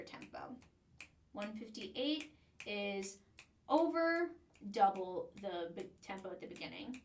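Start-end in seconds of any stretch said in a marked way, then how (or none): none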